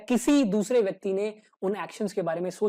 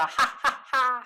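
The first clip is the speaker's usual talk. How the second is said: mock mad laugh; 4 semitones higher, much slower than usual. Shown as a demonstration. Teacher showing how a madman laughs, mock laughter. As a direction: fake a crazed laugh, beat by beat. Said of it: "पागलों की तरह".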